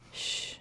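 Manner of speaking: soft, breath-only shush; quiet shushing sound; hushing shh. A narrator's reading. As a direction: hush someone quietly. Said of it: "she pursed her lips".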